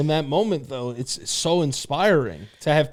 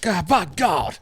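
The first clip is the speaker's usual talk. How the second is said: mock wrestling commentator; 5 semitones higher, much slower than usual. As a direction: exclaim like an appalled match announcer. Mock wrestling commentary, joking along.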